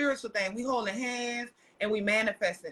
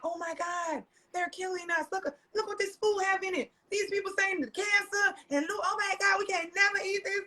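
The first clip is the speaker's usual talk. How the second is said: retold panic, higher pitch throughout; mock alarm; 7 semitones higher than usual. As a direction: play the alarmed girlfriend who just read the food label. Retelling panic to partner about food.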